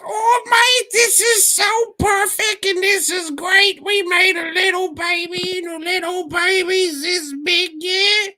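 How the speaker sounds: very high, loud squealing put-on accent; mock annoying actress. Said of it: "who talks like this".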